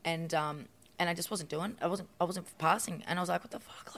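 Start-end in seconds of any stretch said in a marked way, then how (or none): 3.4-4.0 breathy under-the-breath what the fuck; whispered curse. Retold or imitated. Recalling failing tasks on the show, whispered to herself. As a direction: hiss it to yourself in frustration.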